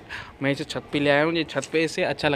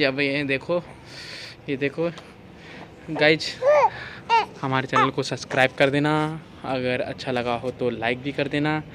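usual speaking voice

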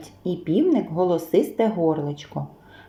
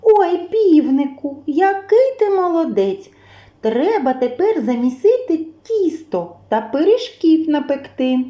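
високий захоплений голос; радісно похвали, високо й протяжно; втішені мишенята; 6 semitones higher, 3 dB louder than usual. A narrator's reading. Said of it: "Поприбігали мишенята і втішилися". (мишенята захоплено хвалять півника)

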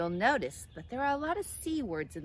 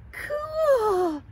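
very high, loud drawn-out squeal; high thrilled exclamation; excited cry; 12 dB louder than usual. A narrator's reading. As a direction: cry out in amazed delight. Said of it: "cried Clara".

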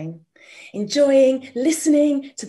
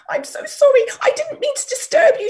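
high squeaky fast apology; scared little mouse; 6 dB louder, much faster than usual. Acted. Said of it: "poor little mouse was quivering".

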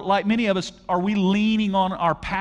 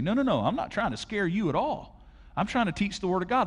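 ordinary talk

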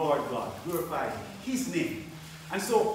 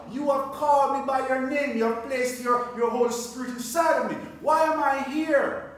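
raised, high-pitched cry to the Lord; impassioned plea to God; 6 semitones higher, 4 dB louder than usual. Acted. Believer asking God why he is in Holland, impassioned plea. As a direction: demand an answer from God, hitting the questions loud.

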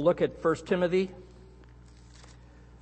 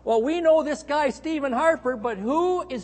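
much higher, baffled questioning; puzzled world leaders; 10 semitones higher, 3 dB louder than usual. Pretend. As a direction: wonder aloud, completely puzzled. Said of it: "the leaders of the nations be scratching their head".

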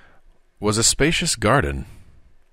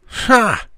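scoffing ha; 6 dB louder than usual. Acted. Tom scoffing laugh.

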